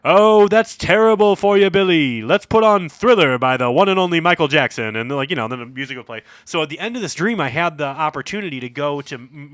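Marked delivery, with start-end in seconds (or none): 0.0-4.9 a cheesy DJ comforting a caller; radio DJ. Retold or imitated. DJ answering a caller in a loud, hammed-up host voice. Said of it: "Murray would be like".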